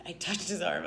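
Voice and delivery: usual speaking voice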